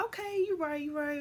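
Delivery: high mock kid; childlike agreement. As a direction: chirp it like a small child.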